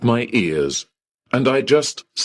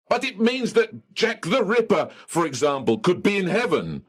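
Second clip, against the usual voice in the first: high indignant voice; 11 semitones higher, 4 dB quieter, faster than usual. Acted. Outraged argument with clerk.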